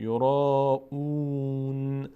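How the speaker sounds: slow chant of یورا اون with held aa and oo; sustained recitation of یورا اون, closing on n; long vowels. Shown as a demonstration. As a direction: stretch both را and اون on a level tone.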